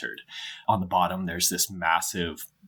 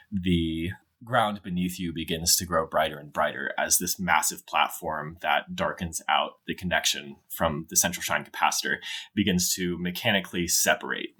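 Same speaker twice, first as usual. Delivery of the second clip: ordinary talk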